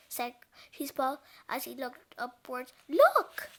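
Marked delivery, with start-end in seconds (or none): 2.9-3.5 high, loud warning; startled storybook shout; alarmed exclamation. A narrator's reading. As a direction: call out in sudden alarm. Character spots oncoming danger.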